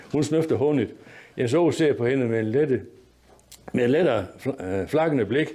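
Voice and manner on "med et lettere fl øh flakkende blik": ordinary delivery, nothing marked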